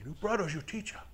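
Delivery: put-on a light German accent, firm and insistent; lightly put-on German accent, telling him off; a soft German accent, stern but quiet. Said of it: "German accent".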